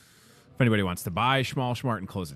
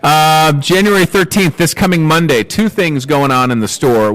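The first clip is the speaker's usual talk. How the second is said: loud, punchy promo read; big store announcer voice; announcer hype; 4 semitones higher, 15 dB louder than usual. Acted. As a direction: sell it like a radio ad.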